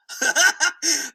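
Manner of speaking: high burst of chuckles; amused laugh. Real, not acted. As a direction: laugh at your own mock threat. Laughs at own horse threat.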